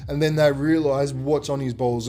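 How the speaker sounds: ordinary talk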